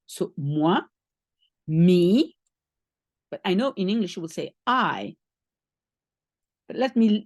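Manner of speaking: ordinary delivery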